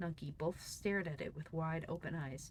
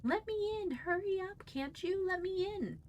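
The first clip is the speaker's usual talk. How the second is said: much higher, louder yelling to be let in; shrill cry; 3 dB louder than usual. A narrator's reading. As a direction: call through the door in a high piercing voice. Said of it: "a shrill voice cried".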